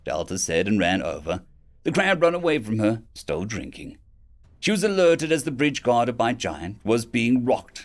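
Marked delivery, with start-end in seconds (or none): none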